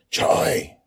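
high loud call across the dark; searching father's call. Acted. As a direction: shout her name. Father calls out for daughter.

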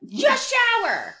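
fed-up friend telling a coworker to wash, slow and high; exasperated. Acted. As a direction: drag out the obvious fix. Exasperated punchline about smelly coworker.

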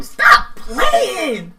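high, loud squeal of disbelief; playful disbelief. Retold or imitated. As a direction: tease him, you don't believe it. She reacts, flirty disbelief.

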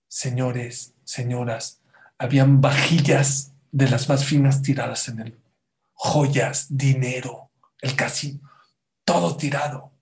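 normal talking voice

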